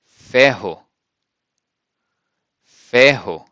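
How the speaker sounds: ferro with a throaty rr, FEH-ho; Portuguese ferro. Shown as a demonstration. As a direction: model word ferro. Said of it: "we use".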